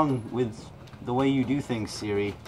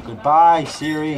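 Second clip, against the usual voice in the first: loud goodbye; 5 semitones higher, 11 dB louder, slower than usual. Real, not acted. Dramatic farewell to the assistant.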